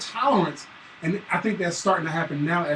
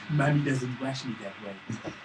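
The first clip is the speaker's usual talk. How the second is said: put-on lower, softer, slow kid complaint; complaining little son; 7 semitones lower, 8 dB quieter than usual. Son complains dad washes him wrong, low and slow. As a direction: pout it out like a small boy. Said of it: "I was like".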